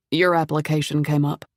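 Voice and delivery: put-on refined foreign accent, not quite British. A narrator's reading. Stranger at the door introducing himself, refined foreign accent. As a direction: introduce yourself with a vaguely European accent. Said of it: "He had an accent".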